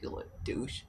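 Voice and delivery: whispered, soft mock insult; muttered tease. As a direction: hiss it under your breath. Teasing insult to cat.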